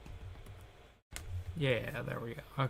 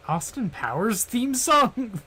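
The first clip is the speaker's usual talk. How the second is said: excited squeal; 9 semitones higher, 10 dB louder than usual. Real, not acted. Excited recall of silly song.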